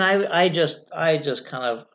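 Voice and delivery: ordinary talk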